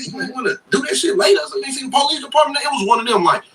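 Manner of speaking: high-pitched disbelieving heckler; incredulous mock questioning. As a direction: retell it as a high, incredulous ribbing. Acting out jailmates' disbelieving questions.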